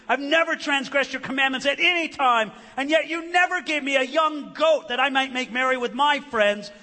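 high, loud, aggrieved protest; angry older son. Acted. Older son complaining to his father, angry protest. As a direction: protest loudly to a father, resentful. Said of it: "But he was angry".

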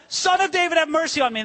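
high-pitched acted call; crying out. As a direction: call high over a passing crowd. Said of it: "he cries out".

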